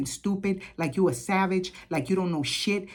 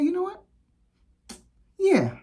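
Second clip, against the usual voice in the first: much higher "you know what? yeah", gleeful; triumphant yes; 12 semitones higher, 3 dB louder than usual. Retold or imitated. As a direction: seize the chance with delight. Agreeing to trespass her, triumphant.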